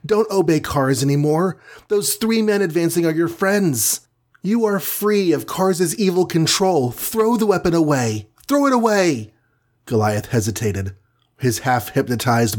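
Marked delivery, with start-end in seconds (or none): 0.0-9.3 high, urgent pleading of a tiny heroine; screamed plea. A narrator's reading. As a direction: plead high-voiced, climbing to a cry at the end. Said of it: "screamed the tiny creature".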